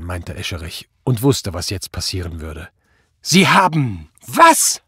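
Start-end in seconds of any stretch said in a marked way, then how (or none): 3.2-4.8 slow, loud, rising disbelief; incredulous outrage. A narrator's reading. Prall hears his officer released the suspect, stunned and outraged. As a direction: drag it out loudly, about to explode. Boss learns prisoner was released.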